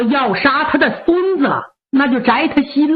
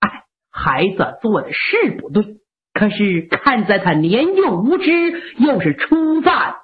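put-on an elderly mother begging gently; old mother pleading for her grandson; 7 semitones lower than usual.

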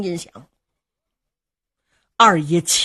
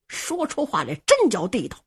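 ordinary delivery

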